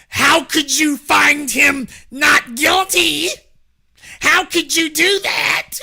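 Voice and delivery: high, loud, drawn-out outrage; imitating the indignant media in disbelief; mad as hell. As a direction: stretch each word in mock-appalled fury. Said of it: "the media was mad as hell".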